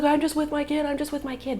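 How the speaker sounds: high-pitched imitation of his protest; mock the man pleading innocence; mimicked excuse.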